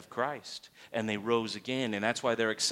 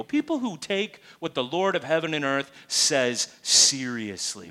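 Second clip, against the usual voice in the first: ordinary talk, nothing marked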